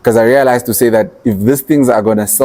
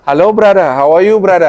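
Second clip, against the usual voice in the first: imitated Indian accent; 7 semitones higher than usual. Greeting an Indian customer in playful Indian accent. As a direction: mimic an Indian accent to sound friendly. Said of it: "An Indian comes out like".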